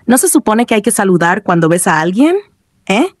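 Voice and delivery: ordinary delivery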